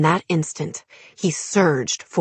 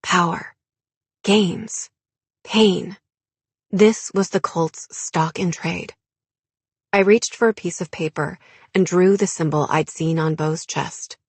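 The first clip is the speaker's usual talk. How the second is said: ordinary delivery, nothing marked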